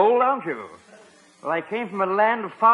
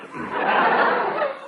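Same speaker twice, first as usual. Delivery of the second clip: nervous chuckle. Acted. Butler alone and nervous in jungle.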